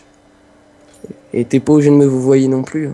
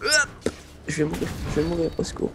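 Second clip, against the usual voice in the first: panicking gamer; 3 semitones higher than usual. Real, not acted. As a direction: mutter the cry for help fast. About to die in game.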